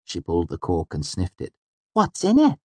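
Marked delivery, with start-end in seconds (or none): 1.9-2.6 very high, curious little voice; Auri. A narrator's reading. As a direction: ask it daintily, pitched high. Auri asks about the bottle.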